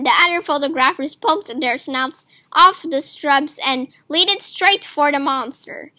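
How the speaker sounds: ordinary delivery, nothing marked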